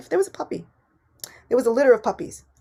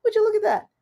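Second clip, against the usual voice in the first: surprise; 12 semitones higher than usual. Retold or imitated. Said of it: "I'm like".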